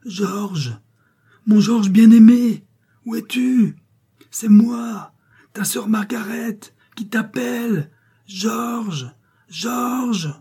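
high, drawn-out desperate call; crier de toutes ses forces. A narrator's reading. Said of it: "elle criait de toutes ses forces". (appel crié à pleine voix, aigu)

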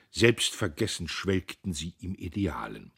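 ordinary delivery

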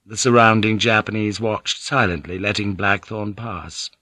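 ordinary delivery

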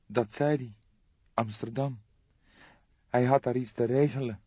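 a shocked young woman breaking bad news; young woman Jolanda. Jolanda answers. (geschokte jonge vrouw, hogere stem)

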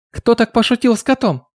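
put-on high chirping girl voice; chirpy voice. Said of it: "чирикнула Люсиль".

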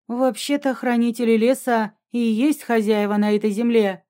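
usual speaking voice

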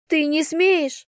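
high, drawn-out indignant protest; protesting cry. A narrator's reading. Girl fighting the man carrying her, indignant cry. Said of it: "закричала я, брыкаясь".

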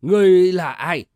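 put-on loud old man's voice; old gatekeeper's challenge. A narrator's reading. Old gatekeeper demands the stranger's identity, loud old man. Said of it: "Lão già lại hỏi".

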